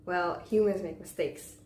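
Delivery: ordinary talk, nothing marked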